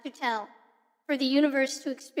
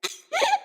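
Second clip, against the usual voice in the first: high giggle; 14 semitones higher than usual. Real, not acted. Relieved to drop the voice.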